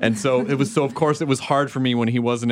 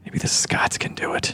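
soft unvoiced whisper; 4 dB quieter than usual. Real, not acted. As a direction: breathe it as a secret. Secret aside.

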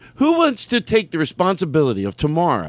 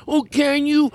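mocking Vinny; 12 semitones higher, slower than usual. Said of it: "he's the guy that goes".

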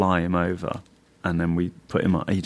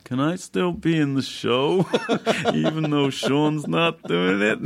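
high, slow, laughing plea; high pleading voice; 9 semitones higher, slower than usual. Retold or imitated. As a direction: beg sheepishly through a laugh. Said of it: "a call from Rich going".

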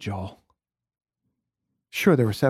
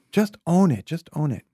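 friend's advice; 7 semitones higher, 7 dB louder than usual. Retold or imitated. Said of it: "who was like".

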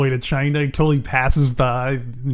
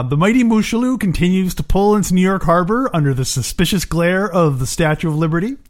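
ordinary delivery

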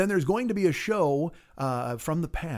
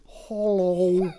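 creepy hello; 4 semitones higher, 4 dB louder than usual. Acted. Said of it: "you just hear".

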